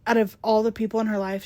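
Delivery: ordinary talk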